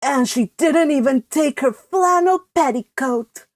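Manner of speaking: a touch of fretful lament; mild mock lament. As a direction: fuss over it mournfully. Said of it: "lamented Cousin Stickles".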